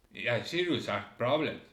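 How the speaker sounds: normal talking voice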